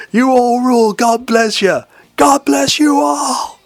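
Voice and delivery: high, loud, drawn-out rock-star blessing. Retold or imitated. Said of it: "he'd be going".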